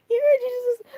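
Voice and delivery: high and loud, playful; excited teasing. Real, not acted. Vlogger to chat about Jesus, high excited tease. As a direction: announce it with a thrill.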